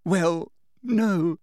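put-on high, tearful girl's voice; mild acted sob; sobbed. A narrator's reading. Hermione answering Umbridge through fake tears, mildly sobbing. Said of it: "sobbed Hermione".

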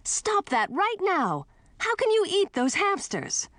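high-pitched, horrified protest; outraged high exclamation; appalled scolding. A narrator's reading. Niece scolds aunt eating hamsters.

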